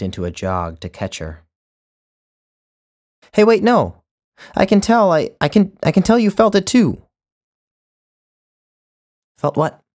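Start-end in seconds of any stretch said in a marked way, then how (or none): 3.3-7.0 loud, high, urgent call; desperate high call to a departing woman; calling after her. A narrator's reading. 9.4-9.8 tossed back over the shoulder; dismissive reply while walking away; offhand. A narrator's reading. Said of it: "without slowing".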